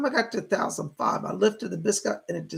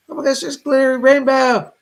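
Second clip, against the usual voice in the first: excited; 6 semitones higher, 10 dB louder than usual. Real, not acted. Thrilled at a donor's gift.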